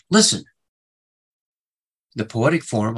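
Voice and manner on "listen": "Listen." barked out high and loud as an order; commanding call. Shown as a demonstration. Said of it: "it's used like a command".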